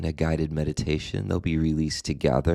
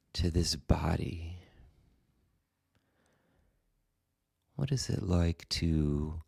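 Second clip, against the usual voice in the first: guided meditation; 3 dB quieter than usual. Acted. Meditation teacher guiding listeners into the body and breath, calm guided meditation. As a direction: guide them gently, leaving space after each phrase.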